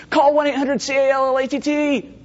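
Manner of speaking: high, hammed-up theater heckler; mock audience member calling out; moviegoer shouting. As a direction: yell the jingle at the screen, half laughing. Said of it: "the guy in the theater's going".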